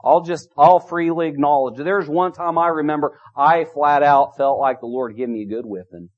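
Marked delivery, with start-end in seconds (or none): none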